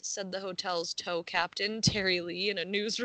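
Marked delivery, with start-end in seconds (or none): none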